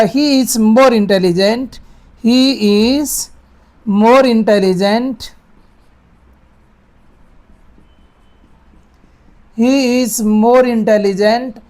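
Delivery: slow teaching read; slow dictation. Shown as a demonstration. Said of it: "तो आ जाएगा".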